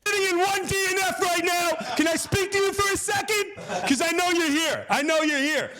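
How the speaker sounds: high, strained calling out; confrontational yell. Retold or imitated. Passenger calls out to the seat's occupant, yelling. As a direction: shout to flush out whoever took it. Acts calling out across plane.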